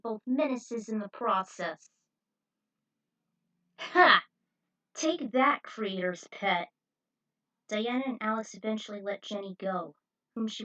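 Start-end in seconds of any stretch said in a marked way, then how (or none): 3.8-6.7 triumphant gloat. Acted. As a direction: crow over the fallen enemy. Gloating after villain dies.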